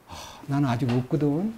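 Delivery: acted soft, low-pitched request; quietly asking a fellow believer for advice; humble inquiry. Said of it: "이렇게 해야 대화가 되는데요".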